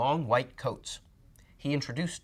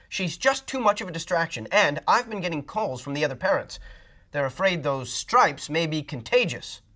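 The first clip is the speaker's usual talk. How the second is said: higher, louder apologetic principal; principal on phone; 6 semitones higher, 6 dB louder than usual. Acted. Principal calling the mother to keep Camilla home. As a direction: apologize but insist.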